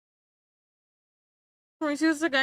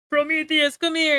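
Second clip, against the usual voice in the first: raised, louder mock summons; calling someone over; 4 semitones higher, 6 dB louder than usual. Zeus summons Prometheus in acted dialogue.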